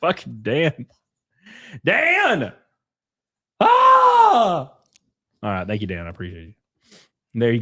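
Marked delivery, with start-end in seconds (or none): 1.9-2.5 calling out. Real, not acted. Calling out to donor Dan. 3.6-4.7 loud high yell of mock rage; frustrated yell. Real, not acted. Yelling at donor's message.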